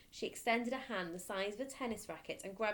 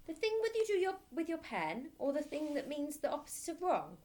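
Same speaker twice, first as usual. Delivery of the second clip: put-on higher, earnest childish voice; small boy; 8 semitones higher, 3 dB louder than usual. Boy clarifying to the headmistress, high and earnest. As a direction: explain it like a careful little boy.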